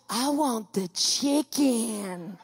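very slow, spelled-out prompt; feed her the words slowly; slow coaching. Retold or imitated. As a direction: nurse prompting Amy word by word. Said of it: "She looks right at Amy and goes".

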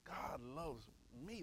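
slow, soft "God loves me"; slow and personal. Shown as a demonstration. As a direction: say "God loves me" slowly and quietly, as something personal. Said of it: "I'm gonna say it slow".

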